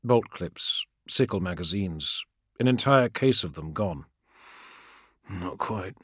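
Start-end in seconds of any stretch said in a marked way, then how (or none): 5.3-6.0 muttered. A narrator's reading. Warrior to himself over looted ammunition, muttered. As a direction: mutter it under your breath. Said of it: "he muttered".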